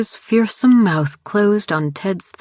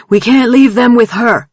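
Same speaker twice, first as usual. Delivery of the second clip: loud higher desperate protest; 7 semitones higher, 8 dB louder than usual. A narrator's reading. Jared protesting Grant's order to abandon them, loud protest. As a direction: push back hard against the order. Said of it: "Jared protested".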